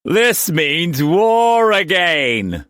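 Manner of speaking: high, loud, drawn-out crow; gleeful proclamation. A narrator's reading. Brock guffawing at the prospect of war, gleeful proclamation. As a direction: relish it, stretching the words out slowly.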